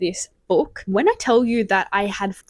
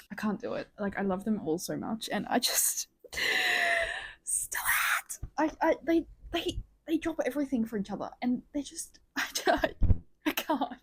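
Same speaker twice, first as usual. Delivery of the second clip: softly choked up; 6 semitones higher, 6 dB quieter than usual. Real, not acted. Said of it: "I'm tearing up almost".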